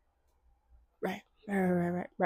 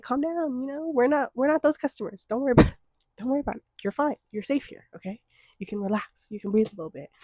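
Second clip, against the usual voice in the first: calm a nervous waiter down; reassuring; 5 semitones higher than usual. Retold or imitated. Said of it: "we're like".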